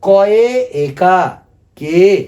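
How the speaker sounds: teacher asks class to repeat, very slow; slow call to repeat. Shown as a demonstration. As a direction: lead the class through कॉय एकार के, drawn out. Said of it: "आप लोग भी बोलिएगा मेरा साथ".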